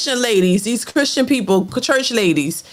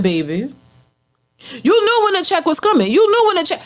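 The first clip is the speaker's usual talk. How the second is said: ordinary delivery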